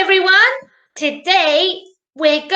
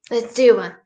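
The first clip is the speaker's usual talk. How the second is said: usual speaking voice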